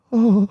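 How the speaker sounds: high, loud swooning sigh; a hammed-up lovestruck gasp; mock swoon sigh. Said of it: "kan jag också bara".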